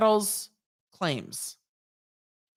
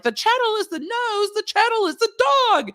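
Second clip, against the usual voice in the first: way higher, louder, excited shout; giddy shouting; 4 dB louder than usual. Retold or imitated. Law students yelling at the husband, high excited shout. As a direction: yell it like delighted law students. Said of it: "And we're like".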